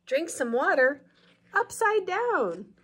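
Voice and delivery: put-on slow storybook reading for kids; picture-book narration; read-aloud narration. A narrator's reading.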